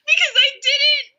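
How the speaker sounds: high, squealed self-justification; mortified explaining. Real, not acted. Explaining her gaffe to friends, mortified and high-pitched. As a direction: explain your gaffe in a high, near-wailing voice.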